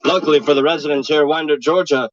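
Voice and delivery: deadpan mock TV reporter; news reporter. Reporter explaining mad cow disease to viewers, deadpan news read. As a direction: wrap it up like field news.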